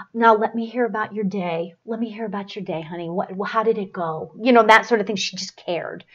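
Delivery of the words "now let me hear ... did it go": soft, tender, much quieter grandmotherly coaxing; grandmother's gentle voice. Retold or imitated. Medium relaying a sweet grandmother's words to Lacey, soft and tender. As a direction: lightly voice a sweet Southern grandma asking about the day. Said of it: "she would say".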